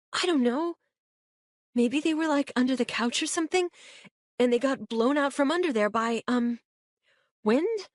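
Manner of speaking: put-on high, hesitant girl's voice fumbling for an excuse; a teen groping for a weak explanation; Kate, nervous teen. A narrator's reading.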